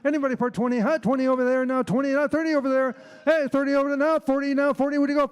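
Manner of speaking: fast rhythmic auction chant; auctioneer. Acted. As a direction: rattle off bids, loud and high. Auctioneer bid calling.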